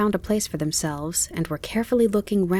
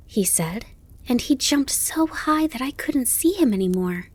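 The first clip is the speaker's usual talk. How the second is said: put-on high, breakneck-eager child voice; excited; 8 semitones higher than usual. A narrator's reading. Fawn describing the grasshopper's leap to his mother, excited. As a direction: gush in a high, wondering voice. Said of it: "Bambi was very excited about his conversation".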